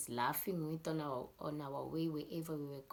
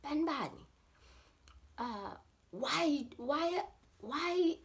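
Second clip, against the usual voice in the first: raised, high-pitched parental scolding; scolding parents; 9 semitones higher, 6 dB louder than usual. Retold or imitated. Parents scolding their boys about the dogs, high and scolding. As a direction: call out like an indignant parent. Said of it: "and then they're like".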